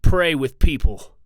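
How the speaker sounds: slow, loud P's in "pray" and "people"; exaggerated popping P's. Shown as a demonstration. Vlogger testing the pop filter, exaggerated popping P's. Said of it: "I'm exaggerating by popping here".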